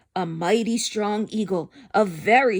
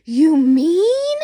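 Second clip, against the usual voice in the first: much louder, higher astonished Horton; gasping amazement; 7 semitones higher, 10 dB louder than usual. Acted. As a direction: gasp it out in disbelief. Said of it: "Horton gasped".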